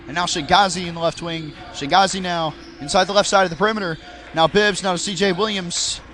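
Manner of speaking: brisk live sports call; play-by-play commentary. Acted. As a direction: call the game as it happens.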